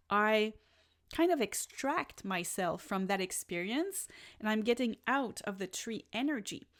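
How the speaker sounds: ordinary talk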